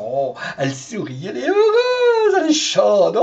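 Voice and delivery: lightly voiced leering husband, jumping high on heureuse; a hint of a smug leering man; lightly hammed-up smug husband; mock Trump voice. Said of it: "il va dire".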